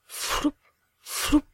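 short, airy floup noise; whoosh of flying hat. A narrator's reading. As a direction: puff it out like a hat whisked away. Hats fly off. (bruit soufflé de chapeau envolé)

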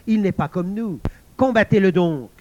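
rabble-rousing villain at full voice; Ikotomé growling. Acted. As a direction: roar the order to fight. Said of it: "gronda Ikotomé".

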